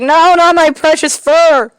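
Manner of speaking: high panicked yell. Acted. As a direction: shriek for your precious fur. Cat attacked by kid.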